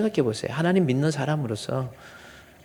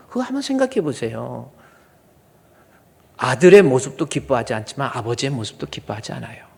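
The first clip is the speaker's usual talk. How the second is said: normal talking voice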